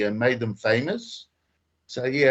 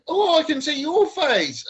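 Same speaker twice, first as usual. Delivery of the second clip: laughing surprise; 13 semitones higher than usual. Real, not acted. Seeing caller's face.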